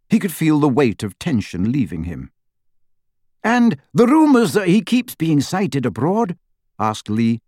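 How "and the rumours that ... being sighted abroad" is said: high-pitched broadcaster's question; animated radio host, pitched up; radio interviewer. Acted. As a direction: lift the voice like a host prompting a guest.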